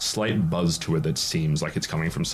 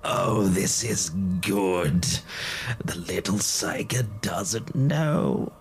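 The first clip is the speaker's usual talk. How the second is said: slow sinister whisper; dark whisper behind you; slower than usual. Acted. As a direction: hiss it slowly from the shadows. GM voices hidden daemon.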